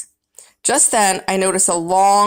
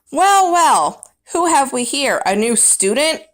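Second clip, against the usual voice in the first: booming janitor; 6 semitones higher than usual. Acted. Janitor greets the surprised hamster in the dark classroom, booming cheerfully. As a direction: boom out a cheerful greeting, only a hint of a character voice. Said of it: "a voice boomed".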